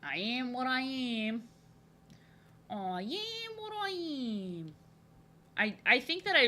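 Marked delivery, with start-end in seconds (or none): 0.0-4.7 very slow, stretched-out put-on voice; drawn-out silly voice. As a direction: draw the vowels out long, playfully. Playful drawn-out catchphrase in put-on voice.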